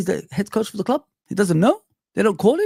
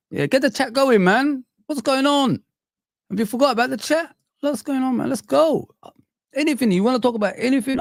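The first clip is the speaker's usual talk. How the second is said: excited; 8 semitones higher than usual. Real, not acted. Streamer urging chat to talk.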